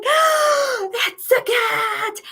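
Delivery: high, loud, slowed cry of shock; shocked gasp. Retold or imitated. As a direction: cry out as the sock turns into a cat.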